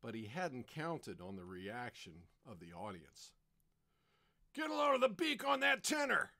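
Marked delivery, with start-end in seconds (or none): 4.5-6.3 high, loud, fast jeer; jeering heckler. Acted. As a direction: heckle from the audience. Audience member heckles.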